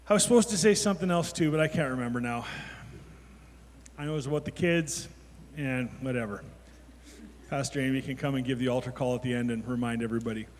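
talking while chewing gum; words round a wad of gum. Real, not acted. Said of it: "I'm sorry, I'm chewing gum".

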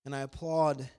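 ordinary talk

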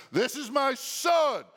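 "This is my son" shouted high and slow; booming voice from heaven. Shown as a demonstration. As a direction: proclaim "This is my son" like God from heaven. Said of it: "that's how the voice from heaven sounds".

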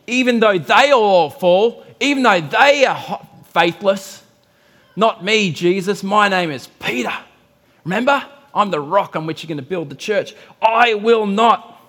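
loud, proud boasting apostle; boastful Peter. Acted. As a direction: proclaim it like an unshakable man. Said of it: "Look at what Peter says".

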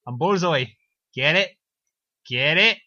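over-eager Irish joker, rising to a shout; hammed-up Irish villain. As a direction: nudge harder with each line in cartoon Irish. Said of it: "'cause just 'cause Bullseye's like".

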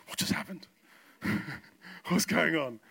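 breathy astonished question with a laugh; acted bewilderment. Preacher voicing viewers as the camera cuts off, mock bewilderment. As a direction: play the baffled viewer, half laughing.

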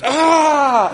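loud, high mimicked scream of annoyance; annoyed scream. Father mimicking his daughter storming off annoyed, frustrated scream. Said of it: "and she goes".

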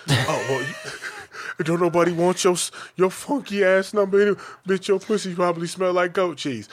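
loud, high-pitched hammed-up trash talk through laughs; mock insults. Rejected man insulting the woman who refused, mock-abusive. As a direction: act out a sore loser hurling insults.

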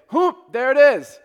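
loud, slow, booming street call; Joseph's call. Retold or imitated. Old man hollering a greeting at the door, loud and drawn out. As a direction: belt it out like the old man on the block. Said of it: "and he would say".

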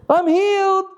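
high, loud burst of joy from the healed man; joyful healed man. Acted. Healed man announcing his cure to the crowd, high and joyous. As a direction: shout it out like you can finally walk.

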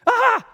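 high, loud freak-out scream; mock horrified scream. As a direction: scream in exaggerated shock. Acts shock at leprous hand.

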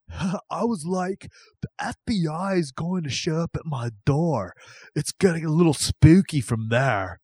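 imitating the prankster. Host quotes Roberts fearing the FBI, mock prankster voice. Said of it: "I don't know that he sounds like that".

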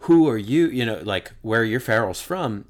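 suddenly loud, eager quizzing; excited question. Retold or imitated. Telling host John about related Farrells, a burst of eager excitement. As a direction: pounce on the shared surname.

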